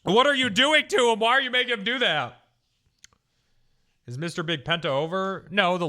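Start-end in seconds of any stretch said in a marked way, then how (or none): 0.0-2.4 high, loud imitation of horrified protest; mock outraged yelling. Streamer imitating chat defending the trolley builder, mock outraged yelling. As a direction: yell it as a scandalized onlooker. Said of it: "chat like".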